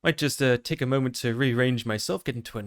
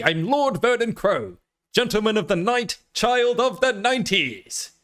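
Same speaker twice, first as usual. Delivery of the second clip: presenter voice; 5 semitones higher than usual. Pretend. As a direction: proclaim your titles with theatrical pomp. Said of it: "put on my best presenter voice".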